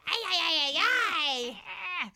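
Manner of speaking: frantic high "ay ay ay"; flustered robot yelp; panicked robot. Retold or imitated. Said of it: "Alpha Five is like".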